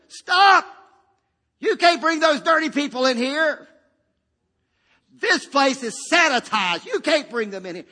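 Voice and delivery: loud, high, barring the door; shouting administrator. Retold or imitated. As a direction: yell to stop them at the door. Administrator blocking dirty patients.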